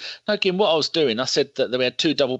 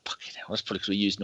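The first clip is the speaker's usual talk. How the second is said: whispered aside; 6 dB quieter, much faster than usual. Real, not acted. Muttered aside about reserves.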